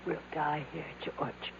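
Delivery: barely voiced, hopeless whisper; despairing whisper. Weak wife to her husband, trapped and starving: faint whisper. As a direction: breathe the words out, worn out and beaten.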